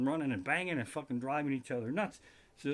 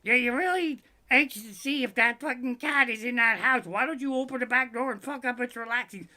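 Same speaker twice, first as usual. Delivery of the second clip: high, loud put-on nagging voice; Sparky; 8 semitones higher, 6 dB louder than usual. Sparky scolding about opening the door on the cat, high mocking nag. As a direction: mimic a nag, pitched way up and scolding. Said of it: "and Sparky will go".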